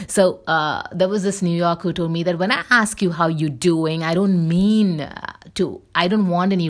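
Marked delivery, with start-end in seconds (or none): none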